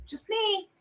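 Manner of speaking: high squeaky self-mocking voice.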